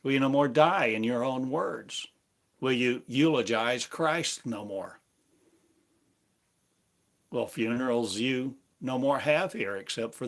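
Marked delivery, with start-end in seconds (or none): none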